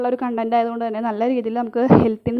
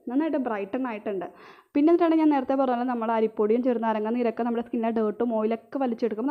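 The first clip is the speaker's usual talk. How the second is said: ordinary talk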